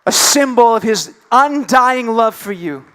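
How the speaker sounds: loud, ringing sermon declaration; forceful preacher driving home the proof; emphatic preaching. Real, not acted. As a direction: proclaim it to the congregation.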